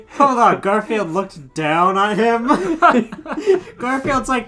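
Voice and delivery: talking through laughter; high, loud, laughing disbelief. Real, not acted. Laughing at the story.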